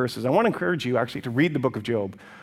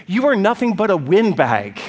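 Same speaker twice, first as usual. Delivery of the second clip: higher, louder acted scorn; scornful insult; 5 semitones higher, 5 dB louder than usual. Eliphaz dismissing Job's empty talk, loud scornful insult. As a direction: throw the insult out loud and high.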